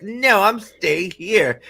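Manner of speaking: mock stubborn daughter; defiant daughter. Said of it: "and she's like".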